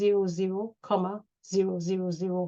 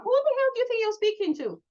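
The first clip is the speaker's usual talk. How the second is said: indignant retort; 12 semitones higher, faster than usual. Retold or imitated. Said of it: "I'm like".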